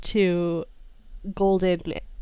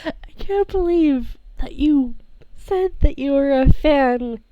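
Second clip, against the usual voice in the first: higher, teasing, slowing at the end; teasing character voice; 6 semitones higher, 3 dB louder, slower than usual. Acted. [name] teases Arata about fanning his own group, higher and slowing. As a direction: tease him, dragging the last words out.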